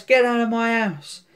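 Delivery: high-pitched indignant order; mock outrage. Acted quarrel, ordering intruder out.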